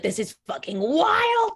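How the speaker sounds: high, slow, stretched-out exclamation; amazed. Retold or imitated. Boyfriend watching friends talk out feelings, amazed.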